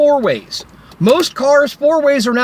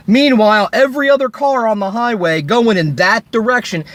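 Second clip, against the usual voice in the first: ordinary talk, nothing marked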